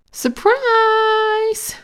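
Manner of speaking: very high, drawn-out surprise call; party shout. A narrator's reading. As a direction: sing it out like a whole party. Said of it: "shouted everyone".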